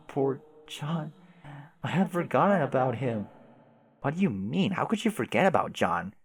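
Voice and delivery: ordinary talk, nothing marked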